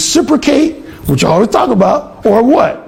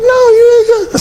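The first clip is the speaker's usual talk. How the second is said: much higher mock woman's voice; roommate insisting. Alan imitating the roommate refusing to let him leave, high-pitched. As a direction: squeak it out like a teasing girl.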